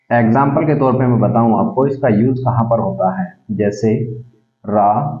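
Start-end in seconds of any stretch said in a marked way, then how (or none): 4.7-5.2 रा held very long and slow; drawn-out vowel. Shown as a demonstration. Teacher demonstrating a pluta vowel to pupils, slow and long. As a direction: stretch रा out to show the prolonged vowel. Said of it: "इसको बोलने में और समय लग रहा है".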